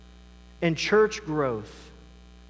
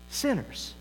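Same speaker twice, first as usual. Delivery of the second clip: usual speaking voice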